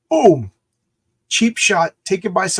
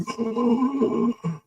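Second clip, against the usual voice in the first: sharp gasp; 9 semitones higher than usual. Retold or imitated. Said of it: "Darren Cross is like".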